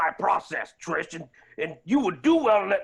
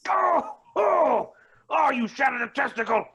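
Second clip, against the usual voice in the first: hit hard crying out; 5 semitones higher than usual. Acted. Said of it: "doubles over in pain".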